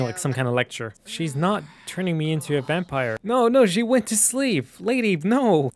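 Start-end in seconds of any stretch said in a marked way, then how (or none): 3.2-5.7 high, slow whiny no; dismayed plea. Real, not acted. Gamer begging the vampire neighbour, high whiny plea. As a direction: plead after her in dismay.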